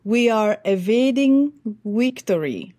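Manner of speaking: put-on slow Ukrainian-accented quote with v for w; imitating Zelensky's Ukrainian accent, slowly. Coach quoting Zelensky's Congress speech in Ukrainian accent. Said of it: "He said".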